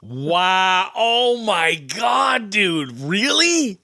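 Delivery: drawn-out high shout of disbelief; stunned disbelief. Real, not acted. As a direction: react like you can't believe what you heard. Host reacts to shocking discount story.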